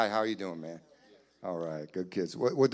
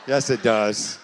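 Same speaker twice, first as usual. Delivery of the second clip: ordinary talk, nothing marked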